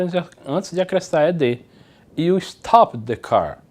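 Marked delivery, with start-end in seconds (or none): 2.2-3.6 very slow, careful English; slow model sentence. Shown as a demonstration. Teacher reads example sentence slowly to class. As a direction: read it slowly for learners. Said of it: "repetiu a última consoante".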